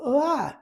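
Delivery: short high startled "ah" of horror; mock horrified cry. Viewer watching the torture scene, mock horrified cry. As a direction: recoil in mock shock. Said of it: "you might look at that and go".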